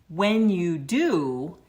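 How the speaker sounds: WHEN and DO drawn out, loud and slow; emphatic quoted warning. Retold or imitated. Preacher quotes God warning Adam about the tree, emphatic. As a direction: stretch "When you do" with heavy emphasis, rising on do. Quoting God's warning, foreknowing the fall.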